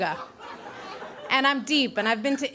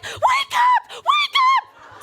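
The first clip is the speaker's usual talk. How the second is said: very high, loud panicked yell; shrill faked wake-up call; frantic shout; 6 dB louder, much slower than usual. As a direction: scream it to fake a roommate. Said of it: "to myself".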